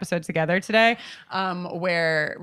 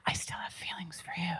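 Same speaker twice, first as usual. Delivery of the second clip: breathy hushed confession; whisper; 2 semitones lower, 15 dB quieter than usual. Retold or imitated. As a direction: whisper it secretly. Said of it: "gets to a hushed whisper and is like".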